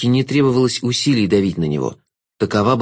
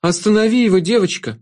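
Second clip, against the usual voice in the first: higher pleading voice; high, pleading shadow voice; 9 semitones higher than usual. A narrator's reading. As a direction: beg the girl to stop him. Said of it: "взмолилась тень".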